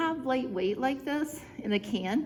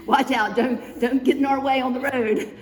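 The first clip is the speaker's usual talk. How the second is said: louder mock warning to other drivers; playful call out; 4 semitones higher, 9 dB louder than usual. Pretending to drive, warning other drivers with a playful call. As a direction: shout at pretend traffic for fun. Pretend driving, calling to traffic.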